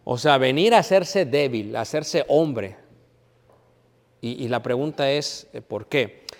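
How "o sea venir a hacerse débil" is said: ordinary talk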